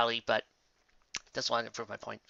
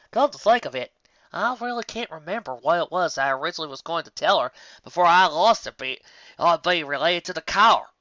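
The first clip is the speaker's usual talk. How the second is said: a touch higher, mildly downcast farm mare; lightly voiced Applejack; 4 semitones higher, 6 dB louder than usual. Applejack telling her family, lightly voiced and downcast. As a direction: read Applejack a little up and rueful. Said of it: "Applejack finished, looking downcast".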